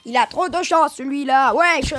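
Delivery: loud jealous outburst; annoyed. Real, not acted. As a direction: blurt it out, fed up. Jealous of friend's luck.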